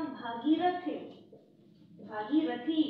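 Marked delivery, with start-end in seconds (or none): 2.1-2.8 Bhagirathi with the final ई stressed and rising; stress on the ending. Shown as a demonstration. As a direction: lean on the last syllable ई of Bhagirathi. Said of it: "ई और जोड़ेंगे".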